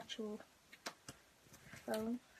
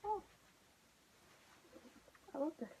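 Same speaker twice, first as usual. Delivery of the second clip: normal talking voice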